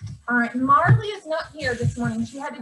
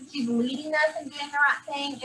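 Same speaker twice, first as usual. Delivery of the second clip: ordinary talk, nothing marked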